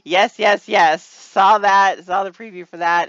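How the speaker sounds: loud burst of eager yeses; excited gushing. Real, not acted. Host raving about a preview she saw, excited. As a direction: fire it out loudly, thrilled. Gushes over a show preview.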